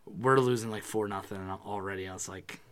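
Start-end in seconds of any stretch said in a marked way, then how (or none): none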